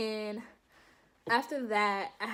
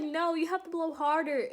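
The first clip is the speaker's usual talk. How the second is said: mock instructor; 6 semitones higher than usual. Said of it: "she was like".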